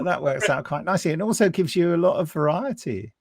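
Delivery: ordinary talk, nothing marked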